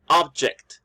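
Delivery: OB-ject, strong first syllable falling away; first-syllable stress. Shown as a demonstration. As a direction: stress "object" on OB, as the noun. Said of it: "the stress is in the first syllable".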